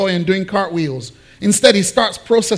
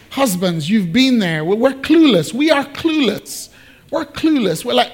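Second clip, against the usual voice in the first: ordinary delivery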